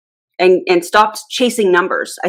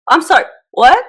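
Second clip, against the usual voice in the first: high, louder incredulous "what?"; taken aback; 8 semitones higher, 5 dB louder than usual. Retold or imitated. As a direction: react in disbelief, pitch jumping up. Stunned by the MLM pitch.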